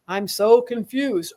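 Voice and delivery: slow, dazed little voice; mock confused Pisces. Imitating a confused Pisces to the listener. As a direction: play Pisces, lost and muddled.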